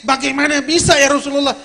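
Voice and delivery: kaget. Retold or imitated. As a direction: ask it loudly in astonished disbelief. (bertanya dengan nada kaget)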